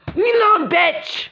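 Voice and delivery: high-pitched angry snap; angry outburst. Acted. Girl telling a rival to leave her alone, angry outburst. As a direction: push the pitch up and lash out. Acted quarrel, telling someone off.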